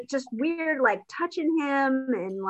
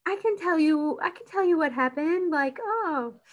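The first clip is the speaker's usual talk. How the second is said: higher put-on Georgina voice; a lightly voiced maid, higher; mimicking Georgina; 5 semitones higher, 3 dB quieter than usual. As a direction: play her as a prim, higher voice. Georgina voiced in retold scene.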